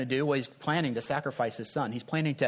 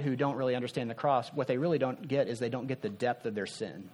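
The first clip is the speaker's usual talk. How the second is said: ordinary delivery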